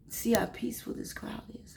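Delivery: soft whisper of the Spirit; a quiet, whispered divine voice. Retold or imitated. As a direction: breathe it like a secret. Voicing the Spirit's words.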